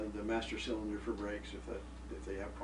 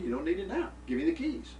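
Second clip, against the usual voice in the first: rising, louder, demanding the keys back; retold angry demand; 13 semitones higher, 6 dB louder than usual. Father tells his son to hand the keys back, mock angry. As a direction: snap it out like a fed-up father.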